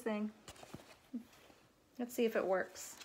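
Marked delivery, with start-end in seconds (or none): none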